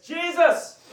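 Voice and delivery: loud, high call across a crowd. Acted. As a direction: shout his name to get attention. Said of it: "he says".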